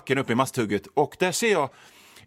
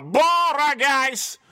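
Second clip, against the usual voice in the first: high, slow, drawn-out call of Bara guys; random man calling out; 11 semitones higher, much slower than usual. Retold or imitated. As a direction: stretch "Bara guys!" out high like a stranger yelling in the street. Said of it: "mannen från ingenstans ropa".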